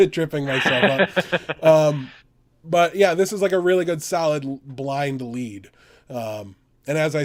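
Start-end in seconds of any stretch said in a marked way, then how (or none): 0.1-1.6 said through a laugh; amused, laughing self-correction. Real, not acted. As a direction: chuckle at your own stumble. Laughs at own stumble.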